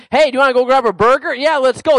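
casual mock banter.

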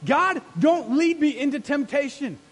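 loud, high, urgent pleading; praying like a street fight; fighting prayer. Acted. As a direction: plead with God like in a battle. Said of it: "This is like".

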